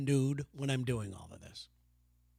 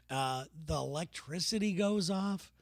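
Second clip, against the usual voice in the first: ordinary delivery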